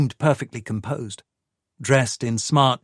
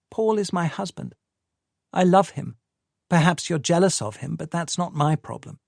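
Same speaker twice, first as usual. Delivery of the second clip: lightly higher, pleading woman's voice; Tamsin's voice; 7 semitones higher than usual. A narrator's reading. Tamsin pushing Michael away, a lightly voiced young woman. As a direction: voice the anxious wife lightly higher.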